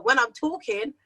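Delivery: usual speaking voice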